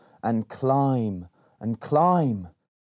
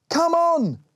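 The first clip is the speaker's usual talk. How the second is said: high, louder call to hurry up; shouted; 5 dB louder than usual. A narrator's reading. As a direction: shout it over your shoulder while running. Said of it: "she shouted".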